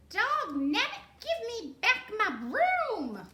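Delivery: high, loud indignant squawk; indignant witch. Acted. Witch scolding the cat that was her broom. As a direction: complain shrilly about the broom.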